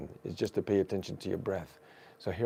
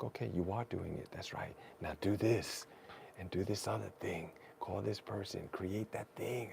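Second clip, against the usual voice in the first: quiet inner answer; 3 semitones lower, 9 dB quieter than usual. Retold or imitated. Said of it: "then it comes".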